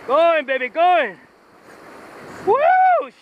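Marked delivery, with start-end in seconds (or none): 2.5-3.1 high, loud whoop of real thrill; sudden joyful yell; excited whoop. Real, not acted. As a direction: let out a whoop as the fish takes line. Fish running line out.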